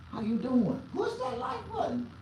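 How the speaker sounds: very high laughing chirp; high giddy like button plea; sing-song greeting. Pretend. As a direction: chirp it like a silly hello.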